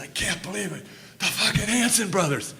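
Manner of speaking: high, laughing, breathless disbelief; laughing high outburst of amazement; starstruck excitement. Retold or imitated. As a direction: gasp it out, thrilled and winded. Out-of-breath star meets heroes.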